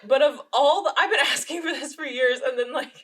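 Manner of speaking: high, loud, amused protest; laughing exasperation. Real, not acted. Mock exasperation over gift irony.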